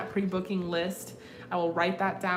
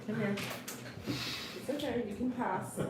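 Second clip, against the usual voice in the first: soft, cooing coax to a nervous dog; softly coaxing the dog over the cords; soft coaxing pet talk; 3 semitones higher, 5 dB quieter than usual. Real, not acted. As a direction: gently lure the puppy across.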